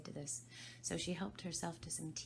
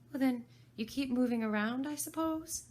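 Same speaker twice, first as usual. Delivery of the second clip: put-on high, louder girl's question; a clever girl guessing; Alice voice; 9 semitones higher, 9 dB louder than usual. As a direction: reason it out as Alice.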